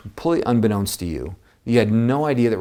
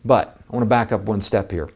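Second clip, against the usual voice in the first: ordinary talk, nothing marked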